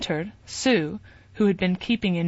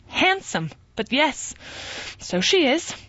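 sharp, much higher jealous retort; jealous Sue; 8 semitones higher than usual. A narrator's reading. Wife bristling at husband praising his ex, much higher.

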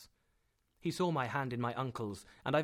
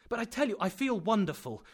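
high, louder insistence; raised, emphatic objection; eager protest; 9 semitones higher, 6 dB louder than usual. A narrator's reading. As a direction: argue impatiently that you're fine. Nephew insists he is well.

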